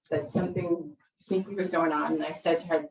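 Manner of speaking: usual speaking voice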